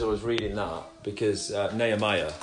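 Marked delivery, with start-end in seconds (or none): none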